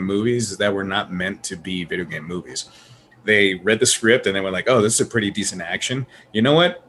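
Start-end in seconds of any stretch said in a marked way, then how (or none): none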